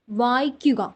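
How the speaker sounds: ordinary talk, nothing marked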